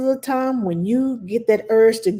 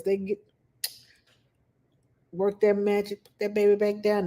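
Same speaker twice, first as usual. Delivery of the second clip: normal talking voice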